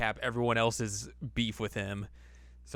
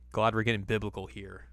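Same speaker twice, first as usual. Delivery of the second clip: usual speaking voice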